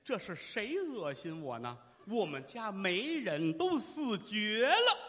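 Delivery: put-on slow, drawn-out old mother's wail; trembling old woman. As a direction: draw it out, swelling to a wail. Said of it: "老太太颤巍巍的声音".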